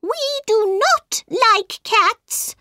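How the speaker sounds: very high, slow, indignant mouse cry; squeaky mouse. A narrator's reading. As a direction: squeak it out slowly like an upset mouse. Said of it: "His voice was high and squeaky".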